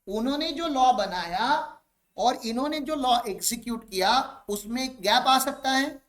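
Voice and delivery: normal talking voice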